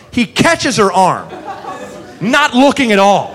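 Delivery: usual speaking voice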